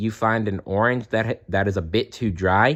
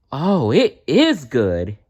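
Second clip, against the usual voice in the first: delighted; 5 semitones higher, 4 dB louder, slower than usual. A narrator's reading. Tasting delicious juice.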